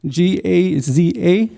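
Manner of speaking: letters spelled out slowly, one by one; slow spelling. Shown as a demonstration. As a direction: spell each letter with weight. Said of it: "and I'll spell it out for you".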